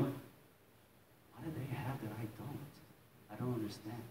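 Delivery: slow, hushed inner questioning; soft, searching confusion; quiet bewilderment. Retold or imitated. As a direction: ask yourself very softly, at a loss. Inner longing questions while crying.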